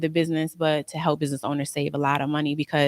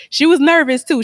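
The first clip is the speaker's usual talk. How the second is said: nervous too; 10 semitones higher, 8 dB louder than usual. Real, not acted. Telling godmother's nervous reaction.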